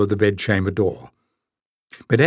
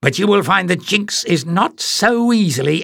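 scheming villain; 8 semitones higher than usual. Acted. Jinx scheming alone.

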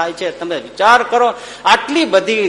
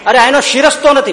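exasperated protest; 7 semitones higher, 5 dB louder than usual. Acted. Man argues with the gatekeepers.

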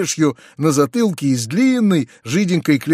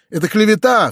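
a mild outcry, pitched up; indignant cry; 7 semitones higher, 4 dB louder than usual. A narrator's reading. Uncle protests the peasant's story to nephew, mild outcry. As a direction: exclaim it higher, somewhat indignant. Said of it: "вскричал дядя".

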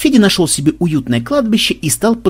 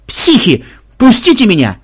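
high, loud desperate shout; panicked yell; 8 semitones higher, 6 dB louder than usual. Acted. Captive screams at his torturers, panicked yell. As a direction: scream to be let go.

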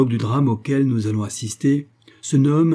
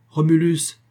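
calling out; 6 semitones higher, 4 dB louder than usual. Acted. Roll call shouted in storm.

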